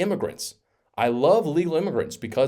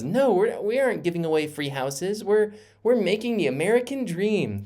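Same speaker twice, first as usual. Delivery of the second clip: high, mocking old-politician voice; Nancy Pelosi impression; 9 semitones higher than usual. A commentator mocking Pelosi's housing defence, high mocking impression. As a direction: play her as prim and preachy, pitched way up. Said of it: "That's my Nancy Pelosi impression".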